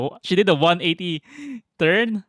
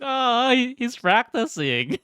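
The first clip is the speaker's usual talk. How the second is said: laughing; 9 semitones higher, slower than usual. Real, not acted. Laughing at brother practicing.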